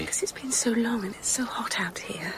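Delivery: breathy unvoiced whisper, close and secret. Audrey to her ex-husband, whispering. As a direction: hush it so no one overhears.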